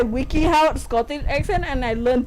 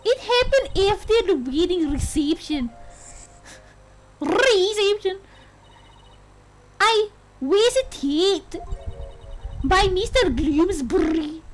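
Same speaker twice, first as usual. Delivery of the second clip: put-on Scottish accent; 3 semitones higher than usual. Bride tells her lawyer of the attack, in an attempted Scottish accent. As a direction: roll the r's like an amateur Scot. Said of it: "could do a Scottish accent".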